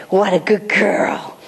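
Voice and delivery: slow, hushed whisper of praise. Shown as a demonstration. Quiet praise to puppy.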